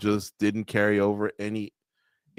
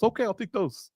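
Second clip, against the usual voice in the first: imitating shrugging fighter; 9 semitones higher, 4 dB quieter than usual. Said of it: "He goes".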